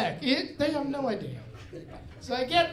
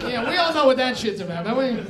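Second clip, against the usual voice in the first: ordinary delivery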